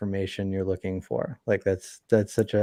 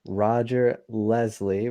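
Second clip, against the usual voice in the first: normal talking voice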